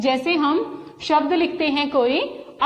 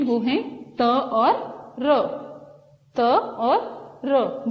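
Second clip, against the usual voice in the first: slow syllable drill; much slower than usual. Shown as a demonstration. Teacher slowly separating letters for children. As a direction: spell out each letter very slowly. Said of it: "उच्चारण से ही पता चल रहा है".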